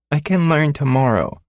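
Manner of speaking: put-on high child voice, stalling; a six-year-old putting it off; pouting little girl. Daughter begging her father to wait, high pouting child voice.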